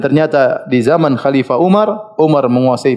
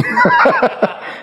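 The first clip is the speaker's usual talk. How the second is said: high-pitched burst of laughter. Real, not acted. Preacher quipping about shirk upon shirk, laughing. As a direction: laugh at your own quip. Amused by his own remark.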